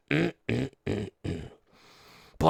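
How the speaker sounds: ordinary talk, nothing marked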